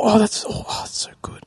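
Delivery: breathy fake delight; whispering. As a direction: whisper it, faking being impressed.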